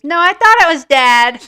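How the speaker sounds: put-on a child blurting it out loud and high; little girl. Little girl answers her mom.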